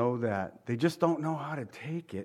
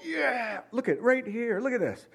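high, cheering like a fan at a score; excited fan yelling at the game on TV; football cheer; 8 semitones higher than usual. Pretend. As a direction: whoop it up like a touchdown. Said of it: "you're gonna go".

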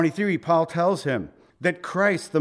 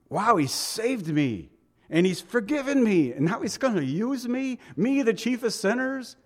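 a lightly voiced Paul, marveling more with each phrase; mock amazed wonder; 7 semitones higher than usual. Preacher voicing Paul amazed at being used by God, rising wonder. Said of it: "That's the way he really thought".

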